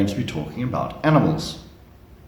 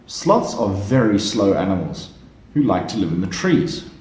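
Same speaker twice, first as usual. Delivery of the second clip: ordinary delivery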